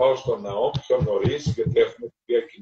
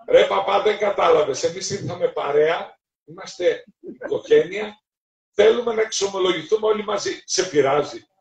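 loud, higher, fast insisting; pushy plea; 6 semitones higher, 4 dB louder than usual. Retold or imitated. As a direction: lean on him like a rowdy kid. Biker insists to the priest. (νεαρός επιμένει στον παπά, δυνατά και γρήγορα)

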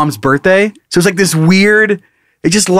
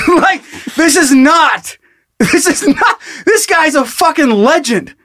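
incredulous; 7 semitones higher than usual. Real, not acted. Disbelief at minivan for dad.

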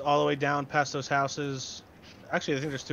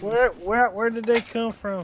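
loud, high urgent yell; alarmed shouting; 9 semitones higher, 9 dB louder, slower than usual. Real, not acted. Gamer hunting for where shots came from, alarmed shout. Looking for the shooter.

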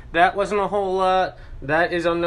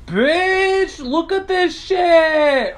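much higher, louder, slow squealing delight; excited shriek; 9 semitones higher, 5 dB louder, much slower than usual. Real, not acted. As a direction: squeal over the finished muffins. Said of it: "I'm so fucking excited".

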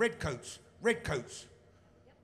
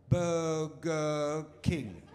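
"Burger King" dragged out syllable by syllable; mock helpful waiter, very slow; 2 semitones lower, 3 dB louder, much slower than usual. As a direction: spell it out painfully slowly. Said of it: "say the name of this place slowly".

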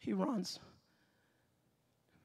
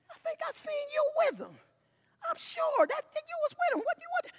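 suspicious woman; faster than usual. Retold or imitated. Suspicious woman pressing Peter.

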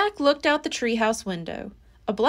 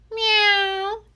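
cat meow; 10 semitones higher than usual. Acted. Cat meows again.

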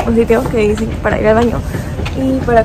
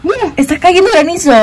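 high, eager hail report; excited; 8 semitones higher than usual. Real, not acted. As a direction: announce the hail again, thrilled.

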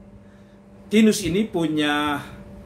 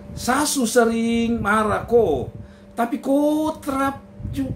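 husband marvelling; 4 semitones higher than usual. Acted. Tinus praises his wife.

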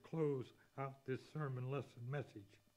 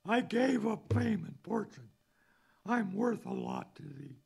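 higher, much louder boast; hammed-up boastful believer; 6 semitones higher, 9 dB louder than usual. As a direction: crow about your sacrifices. Boastful self in hymn.

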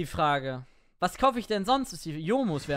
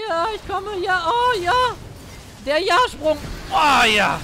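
loud, high gamer hype; excited shouting; 11 semitones higher, 10 dB louder, slower than usual. Real, not acted. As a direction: yell it out in triumph. Hype jump in game.

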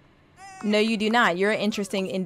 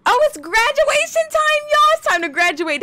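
excited; 5 dB louder than usual. Real, not acted. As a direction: announce it with glee, pitched way up. Excited announcement of graduation.

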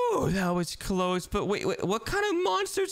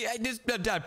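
ordinary delivery

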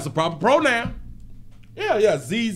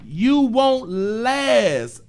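mock quote; 7 semitones higher, 4 dB louder, much slower than usual. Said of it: "She told her baby".

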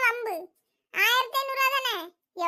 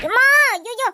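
pained yell; 8 dB louder, slower than usual. Acted. Woman in a hair-pulling fight cries out, loud and pained. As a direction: yelp as your hair gets yanked.

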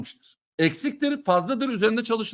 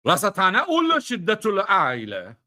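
mock Arabic; slower than usual. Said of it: "bunun Arapçası yok".

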